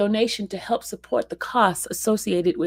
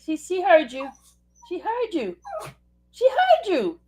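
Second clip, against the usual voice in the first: high sing-song pet voice; baby talk to dog; 9 semitones higher than usual. Acted. Telling the dog Kobe she heard him, high baby talk.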